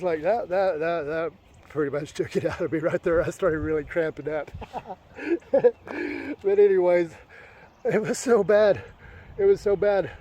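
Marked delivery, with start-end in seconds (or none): 5.2-6.4 wordless burst of laughter; rueful laugh. Real, not acted. Runner recalling cramping up, laughing. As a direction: laugh at your own suffering.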